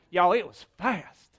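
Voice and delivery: broad southern "Y'all, it was fast"; southern accent. Shown as a demonstration. Pastor hamming up a southern accent for laughs. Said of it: "that sounded southern".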